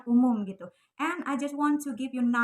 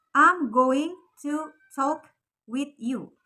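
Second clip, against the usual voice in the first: I'm going to talk with you drawn out slowly, every word spelled out; slow careful full form; 3 semitones higher, 6 dB louder, much slower than usual. Shown as a demonstration. Teacher slowly models the full form for students. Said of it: "Kalau dalam bahasa Inggris lengkapnya itu".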